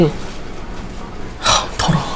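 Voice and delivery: breathy disgusted scoff, voiced kids; a muttered scornful sneer. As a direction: sneer it out with contempt.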